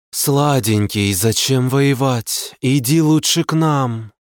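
slow, sultry luring voice; seductive succubus. Acted. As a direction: lure him in sweetly and slowly. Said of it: "Манят меня суккубы".

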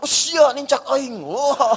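loud, drawn-out shocked cry; mock yelp at being trodden on; startled outburst. Jostled pilgrim stepped on during tawaf, hammed-up startled cry.

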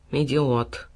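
low, surly cat; gloomy grumble. A narrator's reading. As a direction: grumble it darkly under your breath. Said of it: "мрачно буркнул кот". (мрачное бурчание)

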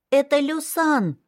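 put-on high, slow child voice announcing; proud announcement. A narrator's reading. Little girl announces her brother's name to the maid. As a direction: show him off proudly. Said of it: "сообщила Мяушань".

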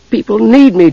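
high, mildly put-upon kender; sighing irritably. A narrator's reading. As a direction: complain with slight irritation. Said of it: "sighed somewhat irritably".

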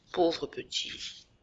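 soft slow echo. Acted. Jacques pitying his brother, a slow soft fading echo. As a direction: let the repeat die away to a hush. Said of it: "je l'entends dire".

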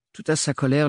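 ordinary delivery

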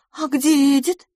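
high-pitched hopeless question. A narrator's reading. Miss LaFosse asks after Edith, hopeless. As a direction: ask high, with little hope. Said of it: "безнадёжно спросила она".

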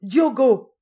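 loud, high outburst of anger; angry cry. A narrator's reading. Old woman confronts the young fisherman, angry cry. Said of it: "s'écria la vieille avec colère".

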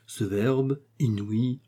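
plain reading voice

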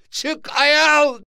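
very high, loud shout; loud, high-pitched order to come out. A narrator's reading. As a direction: yell it out, pitch shooting right up. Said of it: "diye bağırdı".

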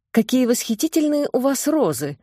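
bright delighted exclamation; exclaiming. A narrator's reading. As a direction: gasp it out in delight. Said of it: "воскликнула Тонечка". (восторженно восклицая)

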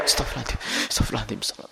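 breathless whispered prayer of remorse; a guilty man whispering astagfirullah over and over; whispered repentance. Acted. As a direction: murmur it under your breath, guilt-stricken. Guilty sinner murmuring repentance.

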